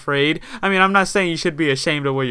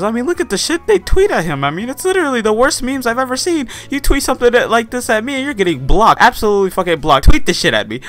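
outraged rant; 8 semitones higher than usual. Real, not acted. Outraged rant about fans' tweets.

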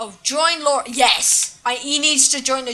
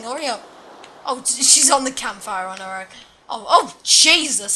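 ordinary delivery

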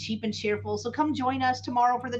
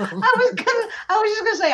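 louder, higher words through laughter; laughing; 9 semitones higher, 6 dB louder, faster than usual. Real, not acted. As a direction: talk while cracking up.

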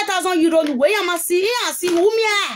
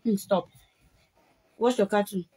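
low, soft order; quiet aside; 7 semitones lower, 7 dB quieter than usual. Real, not acted. Telling a child to watch the cartoon, quiet aside. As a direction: drop the voice for a brief aside.

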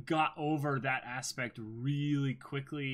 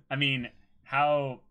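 ordinary talk, nothing marked

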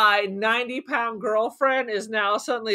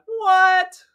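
high, loud mock-baffled yelp; incredulous outburst; 10 semitones higher, 7 dB louder than usual. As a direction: shoot up high in exaggerated disbelief. Acting own baffled reaction to scene.

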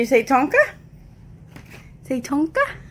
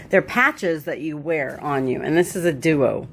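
ordinary talk, nothing marked

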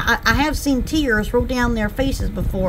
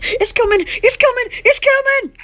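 building excitement; 9 semitones higher than usual. Pretend. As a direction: wind it up, each "it's coming" higher. Building joke announcement.

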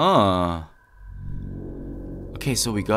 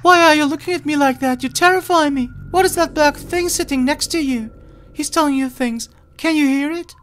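put-on much higher, quick frightened girl; cute high voice; faster than usual. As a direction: rush it like a spooked child. Voices game character.

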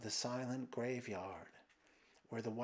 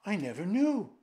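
raised, higher-pitched cry; anguished protest; 8 semitones higher, 9 dB louder than usual. A narrator's reading. Emperor to the deeds haunting him, crying out.

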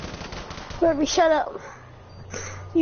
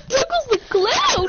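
silly clown; 9 semitones higher than usual. Pretend. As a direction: call out like a party clown. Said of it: "Do you know how to do a clown voice?".

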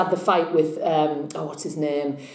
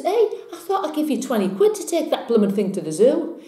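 high-pitched mock indignation; acted complaint, pitch lifted in disbelief; indignant challenge; 7 semitones higher than usual. Man in joke to Paddy about the chimp, mock indignant.

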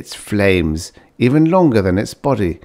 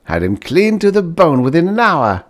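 proud; 9 semitones higher, 4 dB louder than usual. A narrator's reading. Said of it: "said Paul proudly".

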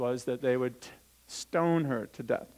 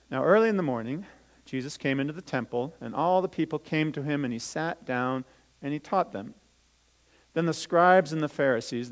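put-on louder, lively storytelling voice for children; Bible story reading; 5 dB louder than usual. Teacher reading the Bible story to children, lively storytelling. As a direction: read it out as a story to the kids.

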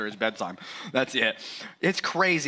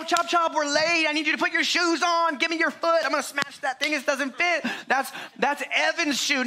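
frantic rushing parent; 11 semitones higher than usual. Retold or imitated. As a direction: rush everyone out the door in a panic. Hurrying kids out the door.

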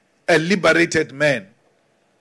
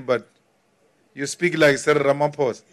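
ordinary delivery

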